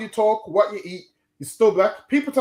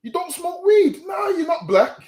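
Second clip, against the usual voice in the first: slow, high mocking voice; mocking quote; 8 semitones higher, slower than usual. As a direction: imitate the critics slowly, pitched up. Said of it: "People say, people say nonsense to me like".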